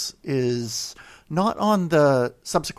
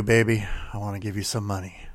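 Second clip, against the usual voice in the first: mock seductive murmur; 6 semitones lower, 5 dB quieter, faster than usual.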